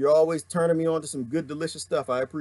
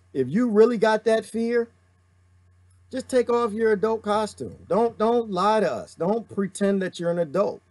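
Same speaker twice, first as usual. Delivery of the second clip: normal talking voice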